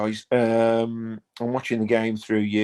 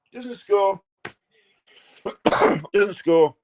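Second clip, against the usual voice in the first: deflated excitement; 8 semitones higher, 4 dB louder, much slower than usual. Real, not acted. Fan on the stream reacting to the missed chip, excited. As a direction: still keyed up, voice falling. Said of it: "Sorry, I got excited".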